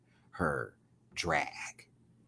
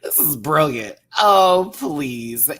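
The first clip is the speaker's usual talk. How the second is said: louder, proud and emphatic on brilliant; loud declaration; 6 semitones higher, 11 dB louder, much slower than usual. Real, not acted. Host to viewers, loudly declaring the look brilliant. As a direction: proclaim it to the whole room. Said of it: "I don't care what anybody says".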